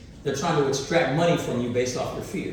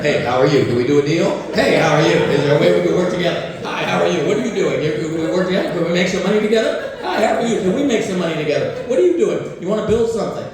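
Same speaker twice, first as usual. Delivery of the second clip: louder, brisk mock networker pitching deals; eager dealmakers; 6 dB louder, faster than usual. Republican event guests pitching deals to everyone, loud brisk mock schmoozing. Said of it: "They're like".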